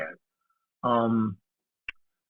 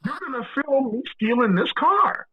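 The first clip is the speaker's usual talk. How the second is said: exasperated insistence; 10 semitones higher than usual. Retold or imitated. Retelling his insistence on stealing.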